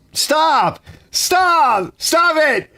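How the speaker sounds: high, loud pleading yells of stop; mock shouting. Telling the friend to stop his awful pitch, mock loud yells. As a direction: beg him to shut up, half joking.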